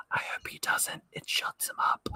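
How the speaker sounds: unvoiced teasing whisper behind his back; whispered aside. Real, not acted. Teasing aside about cohost.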